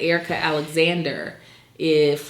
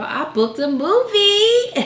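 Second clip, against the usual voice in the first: excited laughing; 4 dB louder, slower than usual. Real, not acted.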